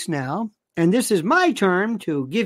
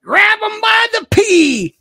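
high, loud Southern holler; drawn-out, exaggerated Southern whoop; Southern affectation; 8 dB louder than usual. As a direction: shout it in a hammed-up Southern accent. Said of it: "may I read it in a Southern affectation voice".

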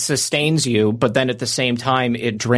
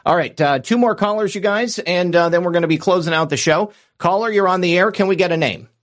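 bright, higher call-in host read; radio host announcer; 7 semitones higher than usual. Acted. Radio host introducing the last callers, announcer voice. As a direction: pitch it up and announce the next caller.

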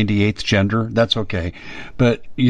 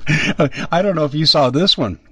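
usual speaking voice

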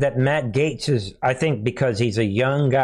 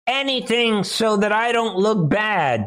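high, hammed-up begging; mock desperate plea; 8 semitones higher than usual. Mimicking a congressman afraid to look bad, high mock plea. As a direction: beg in a high mocking voice.

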